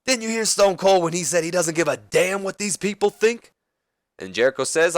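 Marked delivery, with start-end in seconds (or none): 0.0-3.5 high-pitched, insistent promo question; acted indignation, held high throughout; acted wrestler's retort. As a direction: pitch the whole challenge up like a wrestler on the mic. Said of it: "He goes".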